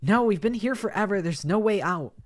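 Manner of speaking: loud, high anguished cry; desperate cry. Acted. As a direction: shout out the hopelessness. Karma cries out in despair.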